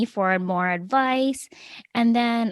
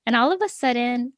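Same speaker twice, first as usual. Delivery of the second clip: usual speaking voice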